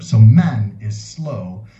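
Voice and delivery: slow, drawn-out explanation; very slow teaching. Shown as a demonstration. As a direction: stretch every word out to show it. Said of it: "teaching word meaning slowly".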